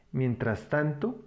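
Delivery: ordinary delivery, nothing marked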